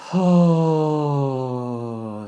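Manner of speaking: long open-mouthed sigh out; audible sigh. Shown as a demonstration. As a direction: let the breath fall out with a sigh. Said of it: "Then open the mouth and sigh it out".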